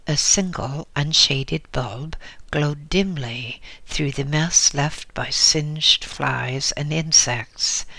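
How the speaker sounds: ordinary delivery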